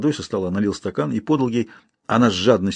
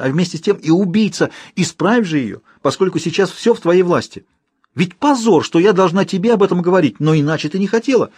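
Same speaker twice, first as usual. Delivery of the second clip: высокий громкий напор; требовать, почти срываясь на крик; гневный крик; 7 semitones higher than usual. Acted. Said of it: "кричала она". (княгиня гневно кричит на крестницу)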